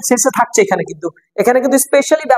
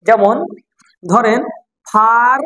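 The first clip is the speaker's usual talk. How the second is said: ordinary talk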